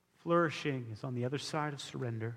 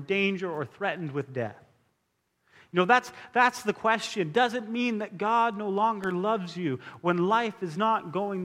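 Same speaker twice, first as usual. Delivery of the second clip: raised, emphatic preacher's delivery; impassioned scripture preaching; 4 semitones higher, 5 dB louder than usual. Acted. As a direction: proclaim the scripture with force, settling down at the end.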